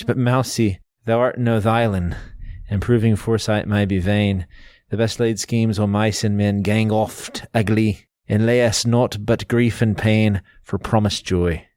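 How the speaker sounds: slow, lightly Scottish recital of Burns; Scottish reading. Shown as a demonstration. Host demonstrates the original Scots stanza, lightly Scottish accent. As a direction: roll through the Burns lines in a mild Scottish lilt, slowly. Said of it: "Here's how it sounds in the Scottish".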